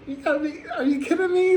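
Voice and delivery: high, laughing disbelief; incredulous disbelief. Retold or imitated. To the rude tourist, a high laughing are-you-kidding-me. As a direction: shoot up high in laughing outrage. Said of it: "I'm like".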